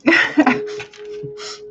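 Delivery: real unvoiced burst of laughter; breathless laugh. Real, not acted. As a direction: laugh silently at her own bit. Laughing at her own act.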